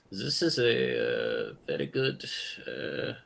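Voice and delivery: slow, low statesman drawl; ponderous old diplomat voice; Henry Kissinger impression. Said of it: "I haven't done Kissinger in a while".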